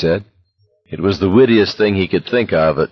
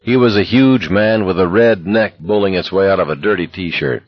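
plain reading voice

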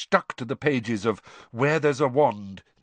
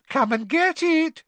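high teasing mermaid voice; mermaid giggling maliciously; 3 dB louder than usual. Acted. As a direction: taunt him, high and spiteful. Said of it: "she giggled maliciously".

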